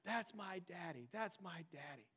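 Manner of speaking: slow, soft, lightly voiced boasting child fading away; a hint of a small boy bragging about his dad; proud little boy. Son telling another kid about his daddy, slow soft child voice. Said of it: "he's just like".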